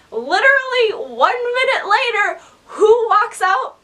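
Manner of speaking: high, emphatic storytelling build-up; excited reveal. Real, not acted. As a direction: draw out the question, then burst out the name. Big reveal of the star.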